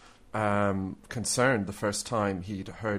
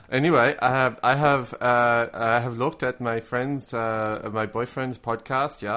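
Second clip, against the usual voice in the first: put-on louder, halting German accent at the start, easing off; mock German accent; 4 dB louder than usual. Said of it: "try to imitate my German accent".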